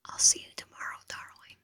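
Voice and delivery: breathy whisper right in the ear; seductive whisper. Acted. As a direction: whisper it close and teasing. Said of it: "familiar, seductive voice whisper in my ear".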